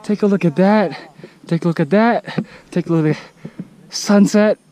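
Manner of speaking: rising awe as the sun sets; excited calling out. Real, not acted. Calling out at sunset view.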